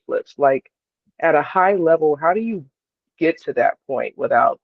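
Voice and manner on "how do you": normal talking voice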